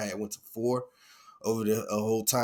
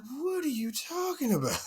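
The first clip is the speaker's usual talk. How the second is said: high confused squeak; 14 semitones higher, 4 dB quieter than usual. Retold or imitated. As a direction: voice jumps up high in mock disbelief. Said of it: "I'm like".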